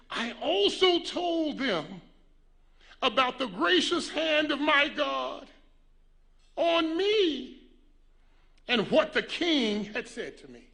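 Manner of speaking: loud, slow, high proclamation; preacher declaiming scripture. Acted. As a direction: stretch each phrase out with ringing force and long pauses. Preacher reading out a scripture quote.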